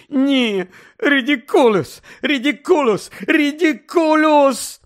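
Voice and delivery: high, loud, rising wail; desperate crying. Acted. Mother failing the spell again and again, sobbing.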